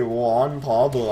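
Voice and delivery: slow, mocking drawl of the name; savoring the name. Real, not acted. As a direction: taste every syllable, amused. Repeating the name in disbelief.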